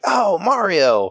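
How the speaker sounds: put-on a child's sudden recognition; recognizing kid. Dad imitating his daughter finally recognizing Mario.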